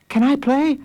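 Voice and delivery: put-on high child voice; high-pitched kid asking; eager little boy. A narrator's reading. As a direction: plead to join in as a small boy. Small boy begs to join.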